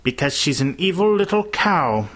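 high, venomous character voice; venomous accusation. A narrator's reading. Teacher from behind the children accusing Emma, venomous.

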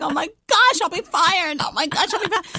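high, hushed fretting; retold inner panic in a high voice; mock panic. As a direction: panic under your breath, playfully. Acts out on-air panic over missing tape.

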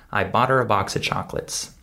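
quick, run-together sentence with "her" and "of" reduced; normal-speed reduced speech. Shown as a demonstration. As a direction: link it all up in one fast flow. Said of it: "Listen again".

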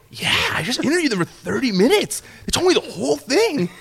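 high, thrilled report to the boss; excited report. Retold or imitated. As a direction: re-enact bursting with a scoop. Breaking big news to the boss.

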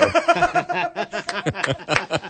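a high burst of laughter at his own story. Real, not acted. Host laughing at the lady's mix-up, high laugh. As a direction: crack up again.

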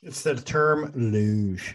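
slow, savoured delivery of luge; drawn-out joke. Real, not acted. Co-host slowly savouring the word luge as a joke. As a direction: stretch the word luge out for laughs. Joking about why luge appeals.

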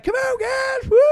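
squeaky high falsetto whoop; senile old man cheering. Pretend. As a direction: play a giddy naked old man. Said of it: "And I'm like".

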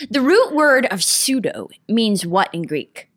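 normal talking voice

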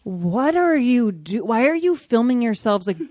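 slowed-down mock disbelief; commenting on the couple's videos, slow and baffled; slow incredulous question. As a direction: drag it out, baffled. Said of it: "I was like".

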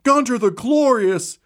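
high, pumped-up ringside call; excited arena announcer. Acted. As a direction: hype the crowd like a fight commentator. Arena announcer calls the fight.

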